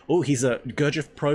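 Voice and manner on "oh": ordinary talk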